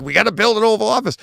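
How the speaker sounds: mock urgent crew. Crew voiced in panic.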